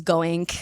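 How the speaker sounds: "goink", going with a K on the end; -ing ending in a K. Retold or imitated. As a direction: imitate her hard K ending on "goink". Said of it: "she does the same thing".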